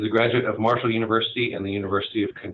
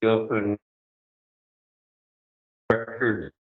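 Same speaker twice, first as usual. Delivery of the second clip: choppy, breaking-up audio; bad connection; slower than usual. Real, not acted. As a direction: voice cutting out over a bad line. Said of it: "you're breaking up on me".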